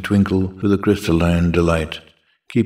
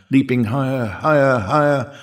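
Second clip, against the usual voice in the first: each higher climbs in pitch; rising higher; 4 semitones higher than usual. Acted. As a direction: step the pitch up on each word. Poem line rising each repeat.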